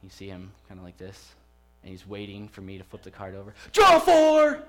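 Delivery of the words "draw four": put-on much higher and louder triumphant yell of a small boy; gleeful shout. Young son slamming his Uno card down, shouting in glee. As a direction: shout it right in Dad's face. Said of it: "just right in my face".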